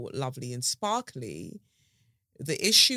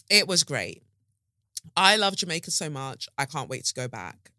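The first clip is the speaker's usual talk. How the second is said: normal talking voice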